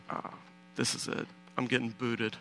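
hushed, resigned inner voice; quiet resignation. Retold or imitated. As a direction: drop to a soft, defeated retold thought. Expects to be deported, retold thought.

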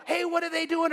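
high-pitched griping question; mock a complainer, pitched well up; complaining. Churchgoer griping about parking volunteers, complaining. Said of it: "You just don't complain".

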